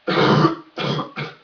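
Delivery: loud, hammed-up hacking cough; exaggerated cough. Vlogger showing viewers his infection with a loud, hammed-up cough. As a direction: overplay a sick cough for sympathy. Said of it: "accentuate with that cough".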